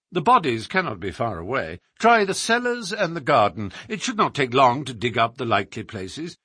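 brisk, emphatic sleuth giving instructions; directing detective. Acted. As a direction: direct a search with assurance. Holmes directs the search.

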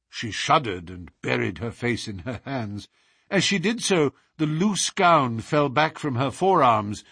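ordinary delivery

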